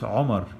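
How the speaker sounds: Omar with a short abrupt O from the throat; Arabic Omar. Shown as a demonstration. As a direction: give Omar a clipped throaty start. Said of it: "it's pronounced Omar".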